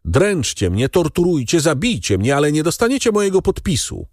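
high-pitched tirade, rising in urgency, not a full shout; defiant outcry. A narrator's reading. Danglars refuses his signature to the bandits, defiant outcry. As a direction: cry defiance at your captors, voice pitched high. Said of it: "krzyknął Danglars".

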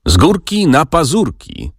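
high-pitched playful call; narrated soldier's exclamation. A narrator's reading. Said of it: "zawołałeś wtedy".

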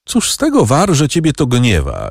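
high, whining groan of complaint; a touch of a weary groan. A narrator's reading. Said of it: "jęknął Mochyński".

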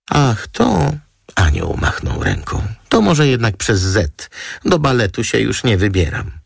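high, dismissive flick of a reply; airy model voice. Acted. Model waving off the dancer meaning, airy and high. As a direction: brush it off lightly and high.

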